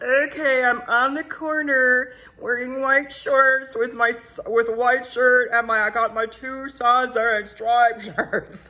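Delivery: high, loud, slow mock voice; Scott's weird voice. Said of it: "His voice was really weird".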